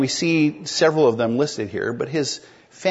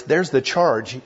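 normal talking voice

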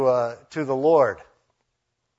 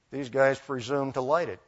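ordinary delivery

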